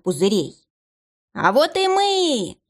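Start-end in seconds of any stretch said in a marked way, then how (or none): 1.3-2.6 high, slow, clownish squeal; silly squealing clown. A narrator's reading. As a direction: announce it like a goofy circus clown. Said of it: "дурашливым визгливым голосом". (клоун дурашливым визгливым голосом)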